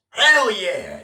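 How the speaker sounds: high, loud whoop of hell yeah; mock cheer. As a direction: cheer it out like a soldier firing. Pregnant soldier cheering while shooting.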